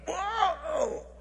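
high, startled yelp; hollered whoa. Retold or imitated. Preacher re-enacting being sucked out of the room, high holler. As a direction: cry out high as if yanked upward. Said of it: "I mean, I literally hollered".

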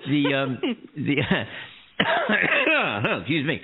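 ordinary talk

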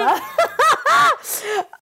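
high, loud burst of laughter. Real, not acted.

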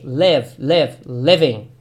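"live" said slowly with a short e-like vowel; teacher drilling "live, living"; e sound in live. Shown as a demonstration. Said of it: "ali mesmo som de E".

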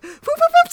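high-pitched whoop noises; playful scooting sound effect; imitated whoops. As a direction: whoop like you're zipping past. Imitating hurried scoot through space.

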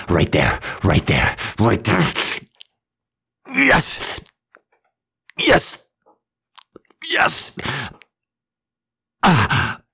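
loud, breathless climax moans; ecstatic lover. Acted. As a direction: urge them on at full pitch. Climax of acted scene.

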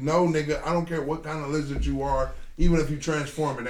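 usual speaking voice

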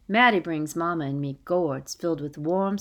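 usual speaking voice

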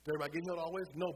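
rising preacher's call; preaching. Acted. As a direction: ask the church with full force. Preacher calling to congregation.

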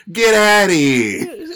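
high, loud, drawn-out New York holler; stretched mock New York yell; mock New York accent. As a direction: bellow it like a hammed-up New Yorker. Acting out the New York video.

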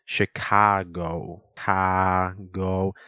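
long drawn-out vowel in "Chicago"; "Chicago" with elongated, held vowels. Shown as a demonstration. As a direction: stretch the "ca" of "Chicago" very slowly. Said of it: "you could hear what I just said".

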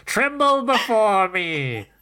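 high, grandiose tiny demon voice; Gaknar. Acted. Tiny fear demon announces himself to the gang, hammed-up menace. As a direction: proclaim each title slowly, drawing out the last words. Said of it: "Kara, do the honors".

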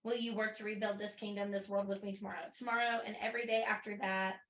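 soft, quiet reading of the last lines; hushed audiobook-style read; story narration. A narrator's reading. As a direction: read the ending tenderly. Reading the final passage aloud.

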